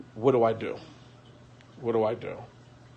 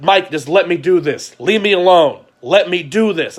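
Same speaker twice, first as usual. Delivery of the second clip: higher, louder, desperate insistence; raised plea; 6 semitones higher, 7 dB louder than usual. Retold or imitated. To his friend on the phone, raised and pleading. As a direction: beg him to back off, voice up.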